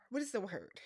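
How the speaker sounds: soft sighed question; sighing. Real, not acted. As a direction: sigh out what is the word. Sigh, searching for word.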